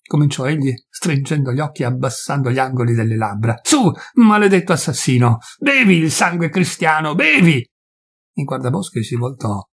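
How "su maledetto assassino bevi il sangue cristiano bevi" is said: high, loud bitter curse; cursing rage. Acted. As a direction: spit the insult at him. Peasant cursing the forester.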